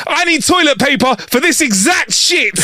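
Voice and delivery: shouted high with a slam; angry shout. Retold or imitated. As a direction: bark it out furious at the counter. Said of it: "slams it on the counter and says".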